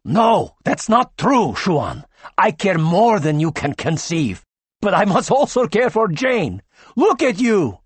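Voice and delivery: doctor passionately defending himself to the crowd; impassioned plea. Acted. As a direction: grip him and make him hear. Tachyon grips him, pleads.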